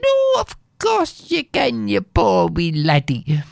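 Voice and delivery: very high, cooing fake sympathy; sweet old woman. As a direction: coo over the poor boy. Innkeeper fakes sympathy.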